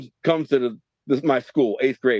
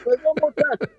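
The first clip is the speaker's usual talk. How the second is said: pleading; 11 semitones higher than usual. Retold or imitated. Begging again for one more.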